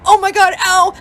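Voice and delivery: high, loud retold yelp; pained cry. Wife running past husband to bathroom, pained cry. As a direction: cry out as if cramping.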